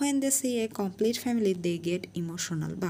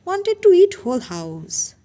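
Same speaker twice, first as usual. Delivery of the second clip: screaming in rage, higher and louder; furious shout; 10 semitones higher, 12 dB louder than usual. Acted. Stepmother screaming her grievance at the family, furious shout. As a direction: explode at the top of the tirade. Mrs Kim's tirade peaks.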